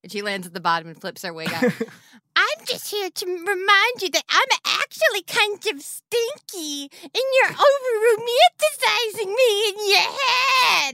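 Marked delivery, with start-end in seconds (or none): 2.4-10.9 high, hammed-up disguise voice; Janice. Disguised Chalice lets Anthony down, high fake Janice voice. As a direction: put on a badly faked high voice. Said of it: "what happened to your voice?".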